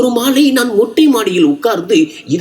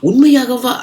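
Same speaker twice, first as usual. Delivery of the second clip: excited disbelief; 7 semitones higher than usual. A narrator's reading. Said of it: "நான் மகிழ்ச்சியில் குதித்தேன்".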